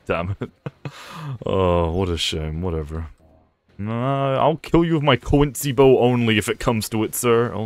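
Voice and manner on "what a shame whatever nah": ordinary talk, nothing marked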